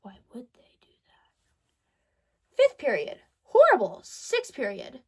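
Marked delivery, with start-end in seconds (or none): 0.0-2.6 slow, breathy whispered mock question. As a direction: whisper it slowly, as if sharing a secret. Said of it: "I was like".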